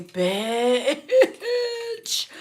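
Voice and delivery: squealing laugh-cry reaction; laughing outburst. Real, not acted. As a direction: crack up on the word. Reacting to read message.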